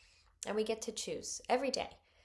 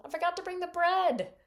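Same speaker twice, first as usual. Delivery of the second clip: higher, louder mock dismay; dismayed disciples; 11 semitones higher, 10 dB louder than usual. Preacher voicing the disciples' forgetful dismay. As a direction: blurt it like a caught-out disciple.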